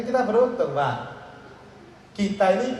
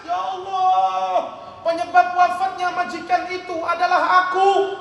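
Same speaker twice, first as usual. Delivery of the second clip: acted wailing; 12 semitones higher than usual. Hasan learns the mistress has died, high anguished mock wail. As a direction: wail it like a guilt-stricken mourner. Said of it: "Tambah nangis".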